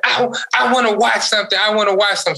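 high-pitched imitation of a protesting child; whining child imitation. Dad imitates his four-year-old demanding to watch something, whining.

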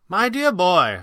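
high, loud, theatrical address; high-voiced magician, indulgent; old showman. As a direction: address him grandly. Showman's grand reply.

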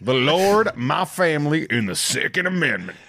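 mock macho Southern good ol' boy; hyper-masculine [name]. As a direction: proclaim it like a hammed-up gun lover. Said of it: "hyper masculine [name] was my favorite".

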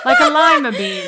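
high laughing voice; laughing mock pronunciation. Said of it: "You were almost close to that".

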